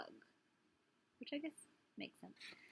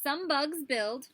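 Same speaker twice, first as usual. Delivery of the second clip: put-on reading to children; 5 semitones higher, 7 dB louder, slower than usual. Reading book aloud.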